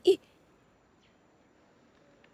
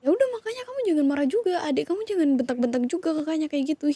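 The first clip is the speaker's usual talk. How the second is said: raised scolding pitch; 4 semitones higher, faster than usual. Acted. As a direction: caught up in the kids' shouting. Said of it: "aku jadi ikut tinggi juga nadanya".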